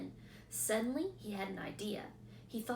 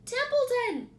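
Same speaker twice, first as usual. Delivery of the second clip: much higher, louder scream; waking the sleeping rat; 11 dB louder than usual. A narrator's reading. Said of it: "screamed Wilbur".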